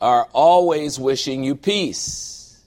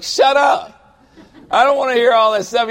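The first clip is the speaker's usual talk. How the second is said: higher, louder mock shout of shut up; exasperated outburst; 9 semitones higher, 6 dB louder than usual. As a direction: blurt it out, fed up with advice. Exasperated outburst at pastor friends.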